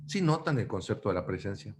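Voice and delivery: ordinary delivery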